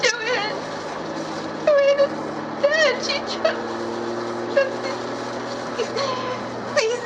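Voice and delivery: high, halting grief; grief-stricken, broken into single words; distraught. Acted. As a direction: choke out the death in a high voice. Breaking news of Joanne's death.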